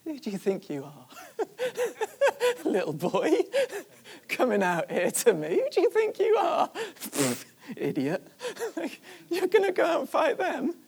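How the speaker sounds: acted scornful brother, laughing through the words; jeering, pitch leaping up into laughter; mocking laughter. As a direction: ridicule him with high, cackling laughs.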